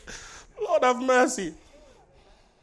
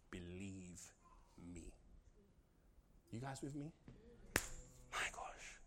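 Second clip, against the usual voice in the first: low, quiet preaching; soft intimate preaching; 12 semitones lower, 15 dB quieter than usual. Acted. Preacher softly tells the church to believe, hushed and low. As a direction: draw them in, hushed and close.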